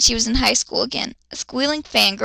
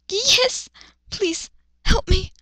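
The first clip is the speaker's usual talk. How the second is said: lightly voiced, higher, slow plea; pleading girl; 8 semitones higher, slower than usual. Luna begging the wolf to save her, higher and slow. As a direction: beg the wolf for help. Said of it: "she asked".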